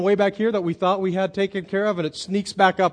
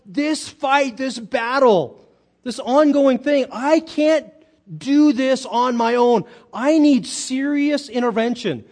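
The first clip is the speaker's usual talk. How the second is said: raised, high-pitched pleading; desperate plea; 7 semitones higher, 4 dB louder, slower than usual. Acted. Preacher voicing Paul at the end of his effort, pleading. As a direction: plead like someone beaten by a long battle. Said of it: "he senses that".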